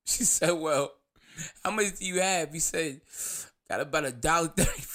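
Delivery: real laughter breaking up the storytelling; fighting back laughter. Real, not acted. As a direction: try to get the line out while laughing. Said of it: "I'm gonna stop laughing right now".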